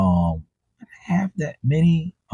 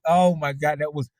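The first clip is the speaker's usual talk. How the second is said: ordinary delivery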